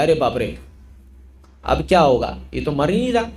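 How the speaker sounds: low-pitched, mildly worried retelling; tense dismay. Retold or imitated. Executioners seeing he will not die, mildly dismayed. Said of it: "जल्लादों को tension आने लग गया".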